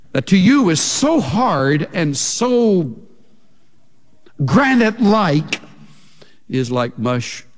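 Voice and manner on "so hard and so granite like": "so hard" and "so granite-like" drawn out and pitched high; emphatic stress. Acted. Preacher describing an enemy's heart, "granite-like" stressed high and hard. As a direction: lean slowly and high on "so", then punch "granite-like".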